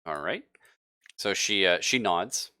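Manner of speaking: ordinary delivery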